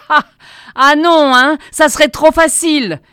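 high, loud, malicious jeer; full of spite. A narrator's reading. Spiteful crowd member refusing the prisoner's plea, jeering with malice. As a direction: throw it back nastily at full voice. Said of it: "Une voix chargée de méchanceté".

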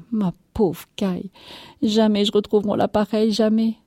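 high, quick sobbing complaint; tearful lament. A narrator's reading. Wife mourning her irreplaceable cow, tearful. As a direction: whimper it with rising grief.

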